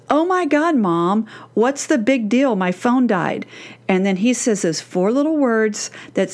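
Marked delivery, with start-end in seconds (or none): none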